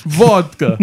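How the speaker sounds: put-on echoing vodka in a laughing Russian accent; laughing mock Russian accent; fake Russian accent. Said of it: "I only speak Russian now".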